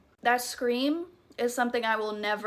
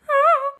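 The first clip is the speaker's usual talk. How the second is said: burst of laughter; 9 dB louder than usual. Real, not acted. Nervous laugh mid-story.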